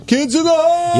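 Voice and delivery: high mock fan yell; shouted name. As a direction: yell it like a heckler. Imitating fans shouting band name.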